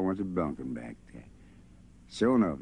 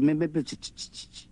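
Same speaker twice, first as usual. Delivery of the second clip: stammering; 7 semitones higher than usual. Acted. Boy stammering on Charlie.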